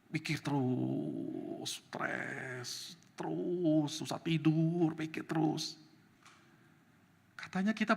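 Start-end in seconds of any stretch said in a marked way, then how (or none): 0.1-5.8 drawn-out, dragging worry; acted-out heavy rumination; weary brooding. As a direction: stretch the words as if worn down. Mimics endless brooding and stress.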